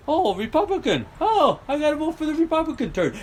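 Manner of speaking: mocking a Republican voter. Mocking voters who pick any Republican, high mock voice. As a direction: parody the mindless party loyalist. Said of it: "they see an R down there".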